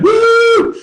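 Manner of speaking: high loud woo; sudden high cheer; excited whoop. Shown as a demonstration. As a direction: whoop like you're pumped up. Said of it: "Get excited".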